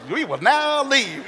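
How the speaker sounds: replayed high and slow, amused at the calm; mock calm. Preacher replaying the pastor's order to the congregation, mock calm and amused. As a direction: ham up the calm order for laughs.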